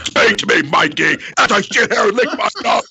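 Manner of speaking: high, loud wrestling promo shout; Ultimate Warrior voice. Pretend. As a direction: yell it like an unhinged wrestler promo. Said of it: "my dog talking to me in the Ultimate Warrior voice".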